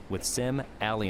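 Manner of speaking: ordinary delivery, nothing marked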